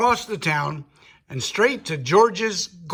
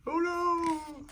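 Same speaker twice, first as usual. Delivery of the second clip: high, breathy squawk of alarm, kept soft; a pirate parrot jolting awake in fright; startled parrot; 12 semitones higher, 8 dB quieter than usual. Acted. Parrot Pete waking in alarm, high soft cry.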